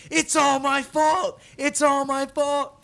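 put-on high, slow kid wail; hammed-up tearful confession; mock wailing. Little boy wailing to his mom, mock sobbing. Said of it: "I was like".

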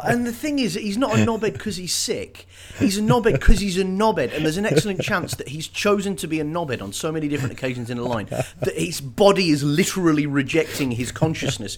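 high-pitched, incredulous rant; exasperated comic rant. Real, not acted. Co-host piling insults on a story character, exasperated comic rant. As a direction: rant in mock outrage, pitched well up. Mock rant about Robin being a knobhead.

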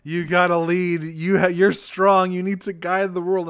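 high pleading voice; mock pleading villain. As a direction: plead like a defeated emperor. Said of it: "He's like".

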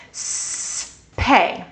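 drawn-out s sliding into pay; s then pay. Shown as a demonstration. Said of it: "we're gonna do some syllables".

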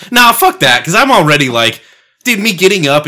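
high, loud burst of refusal; loud outburst. Real, not acted. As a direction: blurt it out loud. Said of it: "outburst about answering door".

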